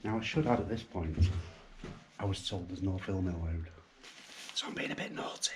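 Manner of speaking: hushed. Real, not acted. Filming secretly where it's banned.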